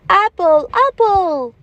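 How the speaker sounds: put-on high, bright chorus of kids repeating; children answering. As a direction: answer like eager pupils. Teacher voices class answering.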